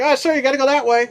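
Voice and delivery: high, fast, overly polite guard voice. Retold or imitated. Guard ushering him out, high quick polite mock voice. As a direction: rattle it off like a courteous guard. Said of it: "he's like".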